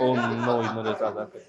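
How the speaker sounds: mock-horrified chuckle at a friend's mistake; laughing mock disbelief. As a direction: tease the blunder through a laugh. Other men reacting, mock horror.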